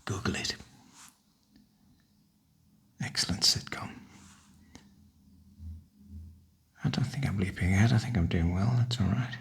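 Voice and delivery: whispered, unvoiced, close to the mic; soft whisper. Real, not acted. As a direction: keep it hushed like an ASMR video. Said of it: "this is a softly spoken video".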